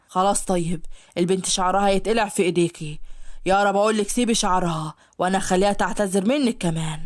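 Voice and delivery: low male voice, firm but placating; calming man's voice. Acted. Ashhab calms Yara while ordering her, low and firm.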